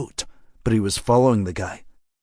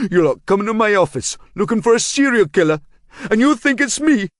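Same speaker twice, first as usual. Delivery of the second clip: put-on high, loud, harsh local-accented accusation; cornered local man hurling the accusation back; enraged suspect; 10 semitones higher, 3 dB louder than usual. A narrator's reading. Suspect with a knife accusing Marshall, harsh angry local voice.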